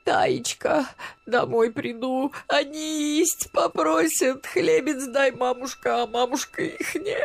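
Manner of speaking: high-pitched grandmother's keening, stretched out; wailing lament. A narrator's reading. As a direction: lament aloud, pleading and distraught. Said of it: "снова запричитала, пока не в голос".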